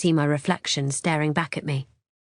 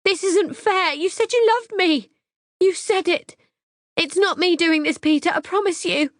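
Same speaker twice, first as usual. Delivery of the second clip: very high, loud desperate protest; high tearful protest; anguished pleading; 13 semitones higher, 4 dB louder than usual. Acted. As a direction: cry out that it's unfair. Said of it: "she shrieks".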